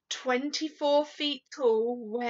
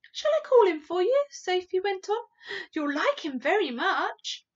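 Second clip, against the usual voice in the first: put-on high, louder eager child voice; a girl keen to show off her friend; little girl Sophie; 8 semitones higher, 7 dB louder than usual. Sophie's voice in story reading.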